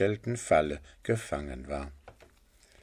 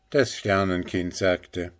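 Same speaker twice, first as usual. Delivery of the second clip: usual speaking voice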